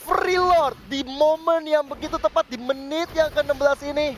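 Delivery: high, loud hype commentary; excited co-caster. Acted. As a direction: ride the teamfight hype.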